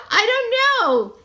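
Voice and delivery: real puzzled laughter; amused laugh. Real, not acted. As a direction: laugh at the mix-up. Monkey looks like a bunny.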